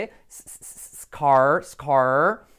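scar started with a hissed s, then said loudly; hissed s onset. Shown as a demonstration. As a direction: hiss the s before scar, no e sound. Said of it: "letra semiclave S". (s siseada al inicio de scar, sin e delante)

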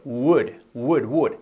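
"Would" with open lips and throat, no b or g. Shown as a demonstration. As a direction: model "Would" starting on an open w. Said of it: "sería siempre abierto".

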